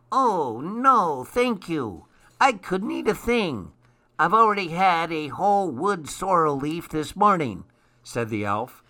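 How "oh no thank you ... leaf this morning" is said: high-pitched, lilting little elf; elf character. A narrator's reading.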